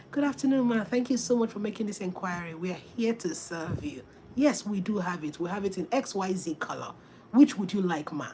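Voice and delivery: a courteous seller greeting a customer; polite warm reply. Retold or imitated. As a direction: welcome the buyer kindly. Polite seller answers customer warmly.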